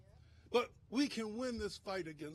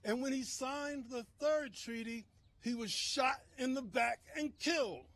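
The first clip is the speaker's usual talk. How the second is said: usual speaking voice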